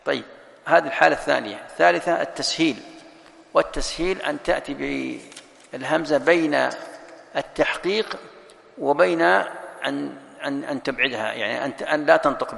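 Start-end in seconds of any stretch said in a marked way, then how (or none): none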